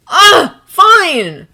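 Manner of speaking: put-on young man yelling as he storms out of the room; yelled. A narrator's reading. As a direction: give up in a loud angry huff. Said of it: "Draco yelled".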